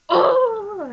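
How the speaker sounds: loud, high startled oh; shocked gasp. Retold or imitated. Viewer reacting as the nurse takes the phone, loud shocked yelp. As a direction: yelp in outrage.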